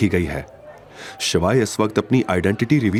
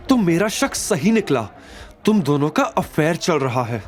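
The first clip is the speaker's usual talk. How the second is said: higher, shrill-pitched scornful female voice; jealous accusing wife; 11 semitones higher than usual. A narrator's reading. Nandini accusing Avantika and Shivay, jealous and scornful. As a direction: accuse them with jealous contempt.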